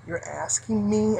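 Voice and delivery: soft incredulous muttering to yourself; hushed sarcastic inner voice; inner disbelief. Retold or imitated. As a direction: think it in quiet disbelief. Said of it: "I said this all inside".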